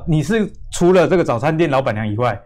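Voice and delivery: ordinary talk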